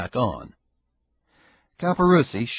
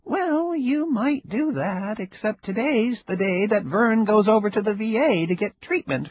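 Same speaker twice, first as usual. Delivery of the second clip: higher, lightly voiced old woman, wry and amused; old Ida, sly; 11 semitones higher, 3 dB louder than usual. Ida needling her neighbor, a higher sly old-woman voice. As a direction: play the sly old neighbor enjoying herself.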